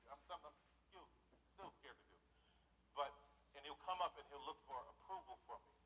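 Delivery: ordinary talk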